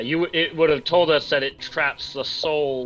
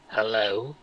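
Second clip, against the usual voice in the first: Nevish's voice, lightly copied; a slightly wrong copied voice; mimic voice; 4 semitones lower, 3 dB quieter than usual. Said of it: "in Nevish's voice".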